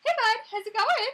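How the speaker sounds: high, loud chirpy hello; mimicked greeting. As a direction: imitate yourself greeting a pet loudly. Mimics own greeting to cat.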